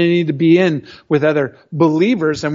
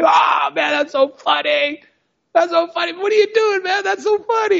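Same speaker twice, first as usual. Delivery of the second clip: high, shouted mock laughing; mocking laughter; 12 semitones higher than usual. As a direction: hoot at him like a buddy on the job. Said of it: "buddies started laughing".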